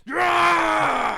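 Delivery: loud high cheer; excited shout. Real, not acted. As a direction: yell it in triumph. Cheering the kill.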